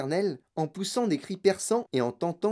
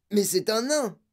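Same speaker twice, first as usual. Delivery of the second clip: higher-pitched outcry; appalled, raised exclamation; shocked yell; 6 semitones higher than usual. Acted. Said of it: "hurla monsieur Tivy".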